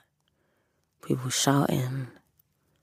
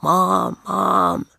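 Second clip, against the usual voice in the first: louder, higher call for mum; retold shouting; 4 semitones higher, 4 dB louder than usual. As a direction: call out for your mum, re-enacting it. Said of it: "I was shouting".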